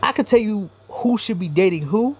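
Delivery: normal talking voice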